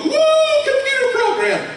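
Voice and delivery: high, laughing fan whoop; mock cheering fan. Copying Audacity fans at a convention, cheering high. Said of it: "when people go".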